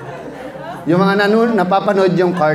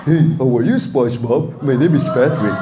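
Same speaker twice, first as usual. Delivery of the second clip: lightly lowered dim starfish; Patrick voice; 3 semitones lower than usual. Shown as a demonstration. Voice actor doing Patrick for the crowd, a touch lower. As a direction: slightly lower dopey Patrick. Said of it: "Lahat ng karakter sa SpongeBob".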